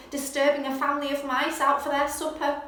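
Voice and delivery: usual speaking voice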